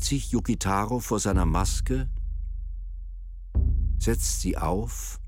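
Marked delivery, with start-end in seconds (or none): none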